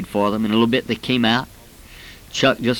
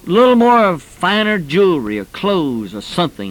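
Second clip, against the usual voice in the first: preaching at full force; 7 semitones higher than usual. Acted. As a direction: drive the list home, pressing harder with each item. Builds to a rising rebuke.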